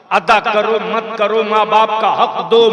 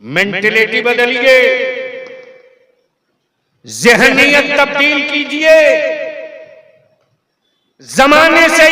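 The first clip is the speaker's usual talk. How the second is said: impassioned oratory; 7 semitones higher, 6 dB louder than usual. Real, not acted. Orator urges crowd to change their mentality, impassioned oratory. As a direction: call out to a crowd, stretching the phrases.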